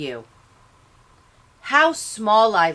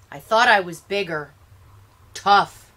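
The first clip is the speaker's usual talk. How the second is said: plain reading voice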